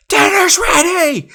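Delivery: piercing, drawn-out mock yell; hysterically loud. Aunt yelling from twenty feet away, hysterically loud. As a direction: imitate an aunt shrieking it far too loud. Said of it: "hysterically loud".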